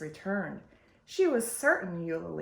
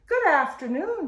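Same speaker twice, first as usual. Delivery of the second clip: higher, louder friendly call; 8 semitones higher, 6 dB louder than usual. A narrator's reading. Neighbour greeting from the road, calling out loud. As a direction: call the greeting across the garden. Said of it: "she called".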